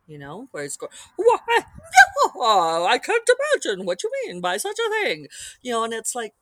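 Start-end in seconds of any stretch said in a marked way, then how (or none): 1.1-5.3 high, louder hammed-up falsetto lady; mock Pythonesque old woman voice; Monty Python-ish man doing a woman. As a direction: parody a prim British lady in falsetto. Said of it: "a little bit Monty Python".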